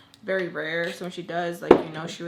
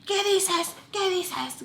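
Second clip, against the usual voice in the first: high-pitched mock outrage in Spanish; imitating pissed stepfather; 11 semitones higher, 5 dB louder, slower than usual. Retelling stepfather's angry reaction to being told off, mock outraged. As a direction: mimic an incensed man demanding an explanation. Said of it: "he's pissed".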